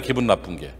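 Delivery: quiet repeat. Shown as a demonstration. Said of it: "기분 나쁜 게 불행한 거예요".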